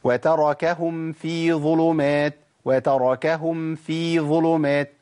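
slow recitation, nasal hum held on هم في; slow Quranic demo of ikhfa; correct ikhfa recitation. Shown as a demonstration. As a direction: recite وتركهم في ظلمات with a hidden mim and humming ghunnah. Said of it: "مع الفاء".

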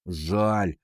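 low, softened single word; mild regret. A narrator's reading. Ванька shakes his head over the apple, mild regret. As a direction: let it drop with regret. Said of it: "покачал головой Ванька".

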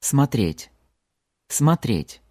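Смотреть said clearly, smatret; Смотреть: reduced first o; slow model word. Shown as a demonstration. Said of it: "To look".

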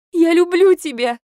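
high, loud tearful cry; wife crying her love to husband; desperate cry. A narrator's reading. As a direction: fling it at him through sobs.

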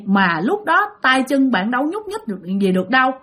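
ordinary delivery

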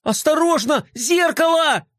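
высокий испуганный вопль; крикни о падающем зеркале. Acted. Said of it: "завопил Флай". (испуганный крик «Осторожно, зеркало!»)

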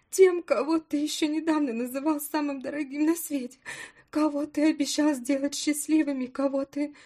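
rising to a loud, high tearful cry; tearful outburst. Acted. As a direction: grief building into shouting. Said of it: "Эмоции взяли верх, подбородок задрожал". (слёзы переходят в крик)

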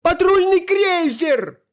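very high, drawn-out wail; usurper's terrified high yell; panicked scream. A narrator's reading. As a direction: shriek it slowly at the top of the range. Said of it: "завопил узурпатор".

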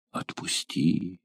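еле слышно, медленно, почти шёпотом; тихо и медленно выдохнуть просьбу; тихая мольба. Acted. Девушка умоляет отпустить её. (тихо, медленно, почти шёпотом «Отпусти»)

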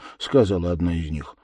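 usual speaking voice

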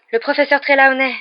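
high, loud, mildly breathless report; out of breath. A narrator's reading. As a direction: blurt it as if just arrived running. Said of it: "dit Harry hors d'haleine".

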